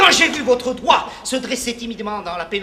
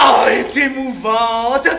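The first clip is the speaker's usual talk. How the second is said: gushing delight; 5 dB louder than usual. Acted. Charlatan doctor gushing over patient.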